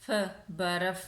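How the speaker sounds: final ف sounded alone, then in برف. Shown as a demonstration. Teacher showing pupils the last sound of a word, ف sounded out in برف. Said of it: "اینڈ پہ کس کی آواز نکل رہی".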